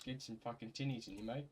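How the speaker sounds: put-on light Australian accent, matey and offhand. Lad urging mates to neck tinnies, in a light Australian accent. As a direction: slip into a casual Aussie bloke urging a beer. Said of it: "I did that in an Australian accent".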